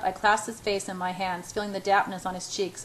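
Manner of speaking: plain reading voice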